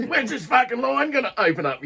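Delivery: high, loud imitation of an irate Australian; agitated Aussie. Aussie waiting in the crowd needing a piss, agitated yell. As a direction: yell it like a fed-up punter in a queue. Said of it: "hear the Aussies getting agitated".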